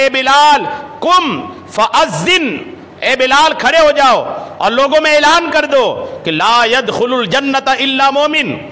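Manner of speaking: high, ringing proclamation with drawn-out words; majestic command. Retold or imitated. Prophet orders Bilal to make an announcement, majestic command. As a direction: call out the order as if summoning a crier. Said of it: "آقا جلال میں تھے".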